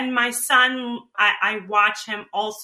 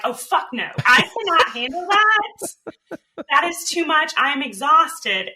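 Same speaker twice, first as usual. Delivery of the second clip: higher, louder exasperated no; worn-out mock outburst; inner refusal; 6 semitones higher, 4 dB louder than usual. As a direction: protest like the body is done. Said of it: "everything in my body was like".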